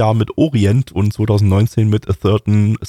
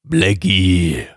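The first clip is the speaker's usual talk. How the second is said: sword voice; 3 semitones lower, much slower than usual. Acted. Voicing the talking sword.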